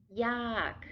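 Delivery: yak with a falling tone. Shown as a demonstration. Teacher models Thai word yak with falling tone. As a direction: drop the pitch down on yak. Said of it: "Falling tone".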